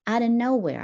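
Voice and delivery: plain reading voice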